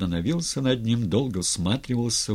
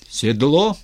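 loud, raised command; giving orders; 5 semitones higher, 7 dB louder than usual. A narrator's reading. Styr as tsar giving orders to his men, commanding. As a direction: call for it like one in charge. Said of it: "распоряжался Стырь".

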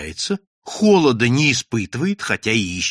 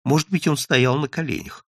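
plain reading voice

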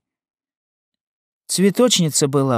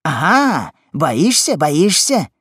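taunting cry, raised in pitch; shouting; 4 semitones higher, 4 dB louder, slower than usual. A narrator's reading. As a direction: crow at a frightened opponent. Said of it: "кричал Симон". (злорадный крик)

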